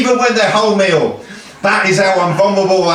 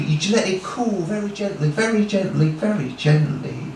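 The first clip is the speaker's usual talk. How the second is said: gently fading; 6 dB quieter than usual. Acted. Telling how the sword cools, gently fading. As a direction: let each repeat settle more softly.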